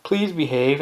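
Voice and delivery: slow, syrupy begging; mock pleading parent. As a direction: parent pleading with a noisy kid, slow mocking plea. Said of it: "they plead with their kid".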